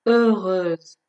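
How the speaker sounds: heureuse with rounded eu vowels and a z ending; clearly finished z in heureuse; careful French model. Shown as a demonstration. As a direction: model heureuse for learners, closing on z. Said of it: "ببینید تلفظش رو دقت کنید".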